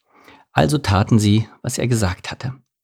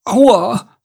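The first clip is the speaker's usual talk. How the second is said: high, loud "ouch"; sudden high cry of pain; pained yelp; 12 semitones higher, 5 dB louder than usual. A narrator's reading. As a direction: yelp as if jabbed. Poked hare cries out in pain.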